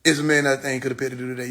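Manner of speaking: rattled off very fast; fast repeat. Real, not acted. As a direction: rush the line the second time.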